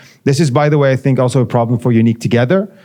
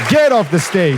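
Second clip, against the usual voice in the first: mock heckle, pitched up high; playful heckler shouting from the crowd; rowdy bar patron; 7 semitones higher than usual. Jokingly heckling at end of talk.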